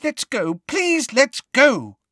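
very high, drawn-out frightened plea; high-pitched, terrified. A narrator's reading. Hermione begs Hagrid to leave the centaurs, terrified. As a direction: plead in terror to get away. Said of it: "high-pitched and terrified voice".

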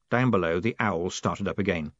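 plain reading voice

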